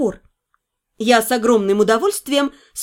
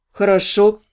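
put-on low, easy young man's voice; a low voice for the fiancé; male lover voice; 6 semitones lower than usual.

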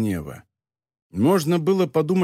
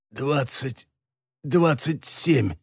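breathed out; much slower than usual. A narrator's reading. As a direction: breathe it out with the last strength. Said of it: "выдохнул барон".

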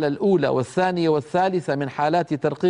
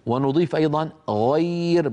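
normal talking voice

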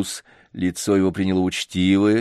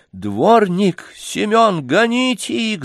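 much higher, angry lady yelling; furious shout; 12 semitones higher, 4 dB louder, slower than usual. A narrator's reading. Enraged lady screams for the yardman to throw them out. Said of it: "закричала с искажённым от гнева лицом барыня".

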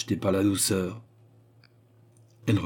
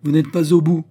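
loud, raised, abrupt retort; brusque; 9 semitones higher, 10 dB louder than usual. A narrator's reading. Queen interrupts her frightened visitor, brusque and loud. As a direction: cut in sharply and forcefully. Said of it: "dit brusquement la reine".

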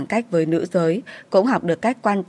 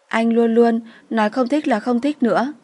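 higher voice, mildly tearful reproach; trembling, tearful; 4 semitones higher than usual. A narrator's reading. Crying woman reproaches her lover, mildly tremulous higher voice. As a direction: raise the pitch, a touch of sobbing. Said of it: "run giọng lên tiếng".

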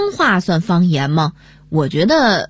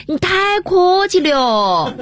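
quoted Sichuan dialect line, slow; Sichuan accent; 9 semitones higher, slower than usual. Retold or imitated. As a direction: imitate the mayor's wife in Sichuan dialect. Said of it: "县长夫人用四川话对张麻子说".

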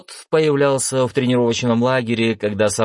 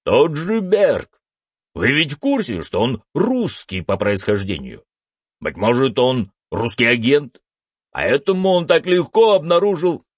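blustery pompous senator; 5 semitones higher than usual. A narrator's reading. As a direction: puff up and hold forth grandly. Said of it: "надув щёки продолжал вещать сенатор".

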